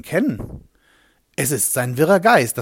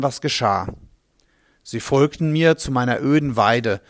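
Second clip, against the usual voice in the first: plain reading voice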